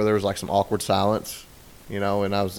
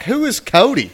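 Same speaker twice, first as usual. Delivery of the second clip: much higher, louder squeaky demand; mock suspicious wife; 11 semitones higher, 6 dB louder than usual. Wife demands who Cody is.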